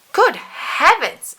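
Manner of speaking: high, slow astonished exclamation; exclaimed. A narrator's reading. Doctor startled by Mister Bounce in his coffee, high slow exclamation. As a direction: gasp it out in shock. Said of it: "exclaimed Doctor Make-You-Well".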